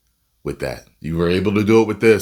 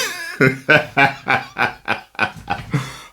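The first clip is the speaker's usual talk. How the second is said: high-pitched cackling laugh; 7 semitones higher than usual. Real, not acted. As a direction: burst into a long, high string of laughs. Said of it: "I'm laughing".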